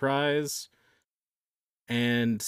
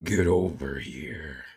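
low, slow growled game villain; Scorpion impression; 9 semitones lower, slower than usual. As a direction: drag it out like Scorpion. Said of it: "Give us your best Scorpion impression".

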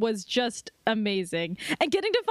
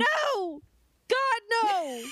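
dramatic no; 9 semitones higher, much slower than usual. Pretend. Mock despair continues.

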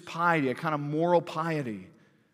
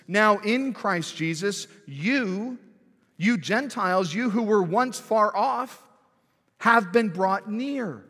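louder, slower, proclaiming each phrase; preached scripture quote; 3 semitones higher, 4 dB louder, slower than usual. Acted. Preacher proclaiming Paul's words to Gentiles, louder and slower. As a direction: declare the promise to the whole room. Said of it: "he says".

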